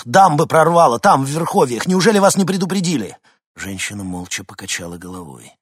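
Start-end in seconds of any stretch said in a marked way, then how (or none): 0.0-3.2 loud, high, urgent warning; hoarse alarm. A narrator's reading. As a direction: raise the alarm loudly, agitated. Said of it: "прохрипел Винсент". (тревожно, почти криком)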